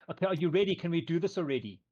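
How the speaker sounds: put-on high, loud impatient teen; impatient teenage girl. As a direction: huff it out, bored and pushy. Nixie voiced again, impatient.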